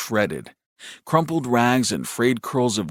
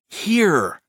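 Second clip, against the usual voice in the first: much higher, falling single word; impatient; 9 semitones higher than usual. A narrator's reading. Brother correcting his puzzled brother, high and impatient. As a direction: insist on it with a touch of impatience. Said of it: "The note in Shep's voice might have been impatience".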